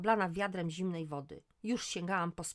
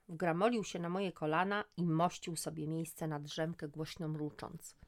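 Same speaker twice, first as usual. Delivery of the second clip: ordinary delivery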